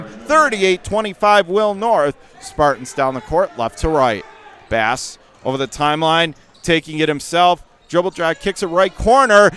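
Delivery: ordinary delivery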